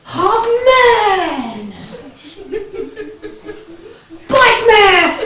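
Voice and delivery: a hammed-up trailer voice; superhero announcer. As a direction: announce the hero with mock grandeur.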